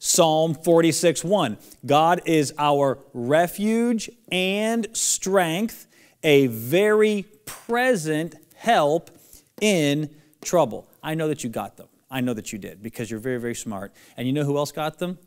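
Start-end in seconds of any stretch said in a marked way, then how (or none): 0.0-10.9 slow and loud, every word drawn out; teacher calling out a memory verse for kids to repeat; slow lead-along recitation. Shown as a demonstration.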